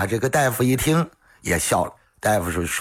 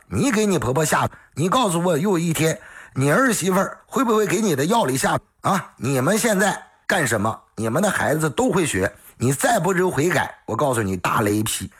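doctor's righteous tirade, pitched higher; stern scolding; 7 semitones higher than usual. Acted. Doctor scolding the scheming daughter-in-law, stern and raised. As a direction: lecture her like a wrathful elder.

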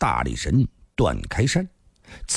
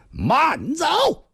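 sharp shout, much higher; sudden shout. A narrator's reading. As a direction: bark the order to stop him. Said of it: "突然大喝一声".

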